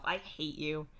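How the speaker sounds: lightly aggrieved; mock complaint. A narrator's reading. Telling Dave off over a pun, mildly aggrieved. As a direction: grumble it with little real heat.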